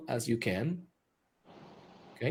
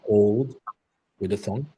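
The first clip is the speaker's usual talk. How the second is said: guided meditation; 2 semitones lower, 5 dB quieter than usual. Acted. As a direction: guide them calmly through the breath. Leads breathing meditation.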